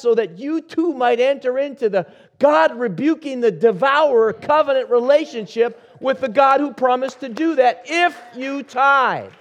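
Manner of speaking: preaching at full force. Acted. Pastor preaches tithing to his congregation, rising sermon fervor.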